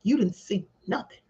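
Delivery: low menacing warning; quiet threat to a witness; threatening. Retold or imitated. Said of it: "manner to be threatening".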